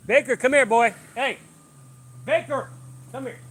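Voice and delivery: loud high commands; repeated firm calls to the dog; calling the dog. Real, not acted. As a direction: call him in sharply.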